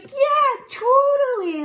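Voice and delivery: high, loud, eager agreement; eager shepherd. Retold or imitated. Shepherd confesses to Oedipus about the baby, eager and high. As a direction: chirp it out like a sheepish shepherd.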